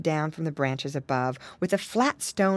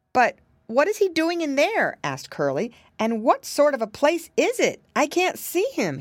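high curious piglet voice; a small pig peering in; little pig Curly, puzzled; 7 semitones higher than usual. Acted. Curly peers into hole.

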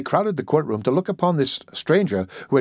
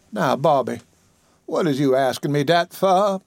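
put-on higher old woman's voice, scolding fondly; chiding old auntie; 5 semitones higher than usual. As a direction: tease him like the child you raised. Dicey chides the attorney.

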